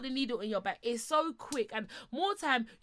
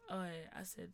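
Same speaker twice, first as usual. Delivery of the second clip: usual speaking voice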